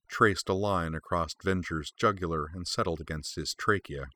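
usual speaking voice

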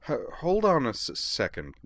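high start, catching on first sounds; stammering. A narrator's reading. Caitlin halts the conversation to check, stammering. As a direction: stutter through the interruption.

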